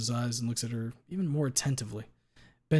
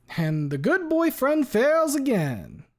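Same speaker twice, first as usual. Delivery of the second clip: higher, louder, slow mocking declaration; loud pronouncement; 9 dB louder, much slower than usual. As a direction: proclaim it to the whole courtroom. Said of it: "loud enough for all to hear".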